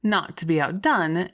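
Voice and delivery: ordinary delivery, nothing marked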